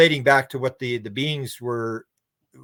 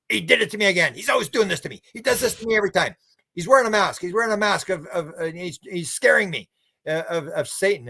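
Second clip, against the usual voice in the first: high, fast, put-upon whine; exasperated complaint; 5 semitones higher than usual. Retold or imitated. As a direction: grumble about the guide's old trick. Preacher complains about his spirit guide.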